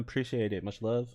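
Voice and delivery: usual speaking voice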